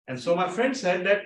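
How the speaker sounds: usual speaking voice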